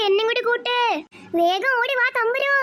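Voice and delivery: panicked calling. Acted. Children fleeing angry mother.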